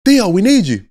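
loud, high urgent call; imitated coach summoning his player. Player imitating coaches calling on him, urgent shout. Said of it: "the coaches be like".

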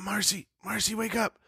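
put-on soft whisper, high child voice. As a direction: whisper to wake someone at night. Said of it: "I was like".

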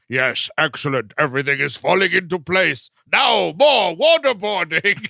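gloat, then draw out the command; relishing villain. Acted. Boss enjoying being waterboarded, gloating villain voice. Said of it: "and he was like".

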